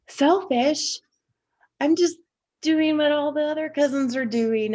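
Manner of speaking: high-pitched, baffled self-defense; retold childish disbelief, pitched well up; indignant child. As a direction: protest like a wrongly accused kid. Child's indignant thought after the scolding.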